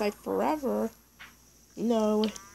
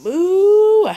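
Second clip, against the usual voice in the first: high, loud mock cow moo; 11 semitones higher, 12 dB louder than usual. Mooing at the cows, loud cow imitation. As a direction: bellow like a cow.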